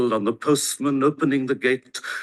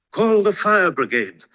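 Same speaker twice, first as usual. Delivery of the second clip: higher, louder call for help; a higher, louder alarmed plea; frantic cry; 7 semitones higher, 4 dB louder than usual. A narrator's reading. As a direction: shout it out in a fluster. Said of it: "cried Missus Prothero".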